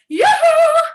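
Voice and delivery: high, loud call across the street; calling yoo-hoo. Shown as a demonstration. Teacher calling to a friend on the far side of the street, a high loud call. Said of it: "you're just gonna go".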